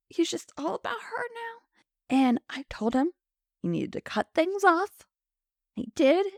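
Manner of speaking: high, mock-tearful complaint; hammed-up scorned widow. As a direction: whine it like a wronged soap wife. Widow continuing her melodramatic account.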